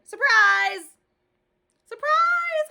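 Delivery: very high, loud shriek; hollered surprise. A narrator's reading. Grace shrieking surprise at Junie, very high and loud. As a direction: shriek it with glee. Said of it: "hollered my bestest friend".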